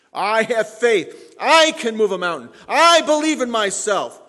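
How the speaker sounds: loud, high declaration, stretched opening words; hammed-up self-confident proclamation; mock boasting. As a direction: boom it out like a chest-thumping believer. Mimicking boastful self-believers.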